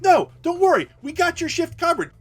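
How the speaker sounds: high, loud reassuring shout; loud reassurance. Retold or imitated. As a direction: shout him off worried, shift is covered.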